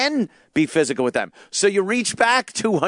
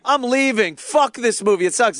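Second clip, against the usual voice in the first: high, indignant moviegoer protest; mock storming out; 7 semitones higher than usual. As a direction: announce a huffy exit in mock outrage.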